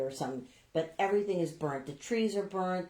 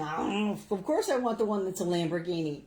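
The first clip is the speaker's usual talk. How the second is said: mock man. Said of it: "he thinks like a, a man".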